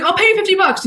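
high, urgent retold offer; mock desperate plea. Bribing roommate to catch roach, mock desperate plea. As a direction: blurt it in comic panic.

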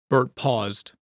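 ordinary delivery

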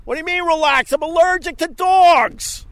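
high, loud exasperated shouting; angry protest. Real, not acted. Snapping back at the dog owner, angry and high. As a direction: demand it, outraged and shrill.

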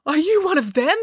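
high-pitched startled gasp and question; yelped. A narrator's reading. Dad flinches at a human-sized unicorn, yelping. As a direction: recoil in alarm, voice leaping up. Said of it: "he yelped".

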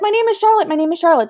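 put-on high-pitched little girl voice. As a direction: imitate a small child announcing herself. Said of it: "And she's like".

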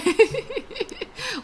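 high, light giggle; real amused laughter. Real, not acted. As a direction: burst into a run of high giggles. Amused after the word wrong.

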